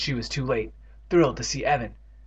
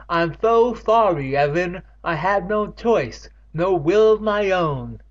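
higher, louder, slow pleading lament; anguished apology; 6 semitones higher, 5 dB louder, slower than usual. Acted. Catherine apologizing to Evan, slow raised pleading. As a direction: beg forgiveness, slow and raised.

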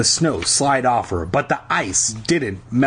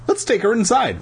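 high-pitched, urgent suggestion; frantic; 10 semitones higher than usual. A narrator's reading. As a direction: urge it anxiously, pitch raised. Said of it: "I suggested frantically".

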